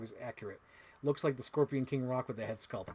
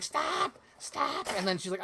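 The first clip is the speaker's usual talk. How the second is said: high-pitched mock panicked cry; exaggerated streamer imitation; 12 semitones higher, 4 dB louder than usual. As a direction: squeal it in a high, comic voice. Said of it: "she doesn't sound like that".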